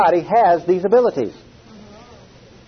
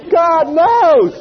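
much higher, louder exclamation; excited revelation; 12 semitones higher, 5 dB louder than usual. Real, not acted. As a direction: cry it out in awe.

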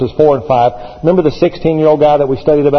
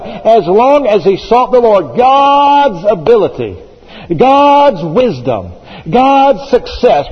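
rising preaching refrain; 5 semitones higher, slower than usual. Acted. Preacher to his congregation, each line climbing higher.

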